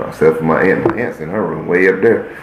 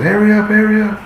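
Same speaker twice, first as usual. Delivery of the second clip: high piping mock teacher call. Teachers hurrying kids in from recess, high mock call. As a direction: squeal it like a nagging teacher.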